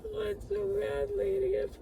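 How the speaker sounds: choking up mid-sentence, high and cracking; crying. Real, not acted. Grieving her dog, sobbing through the words. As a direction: voice breaking into high sobbing cries. Breaking down over lost dog.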